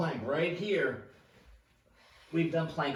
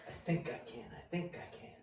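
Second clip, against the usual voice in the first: quiet self-talk; 2 semitones lower, 8 dB quieter, faster than usual. Shown as a demonstration. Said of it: "Have that positive narrative going on in your brain".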